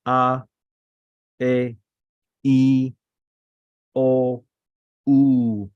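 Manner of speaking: long held "A, E, I, O, U", each on its own; vowel demonstration. Shown as a demonstration. Teacher repeating the important vowels, drawn out and separate. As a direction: sustain each of "A, E, I, O, U" firmly, pausing between. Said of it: "let me repeat, the vowels".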